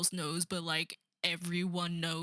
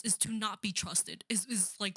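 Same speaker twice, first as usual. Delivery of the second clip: ordinary delivery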